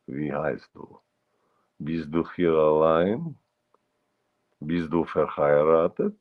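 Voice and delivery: slow, softened Russian-accented German. Shown as a demonstration. Showing off a favorite character, Russian-accented German. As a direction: speak German like a Russian, drawn out. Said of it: "I do have a German with a Russian accent".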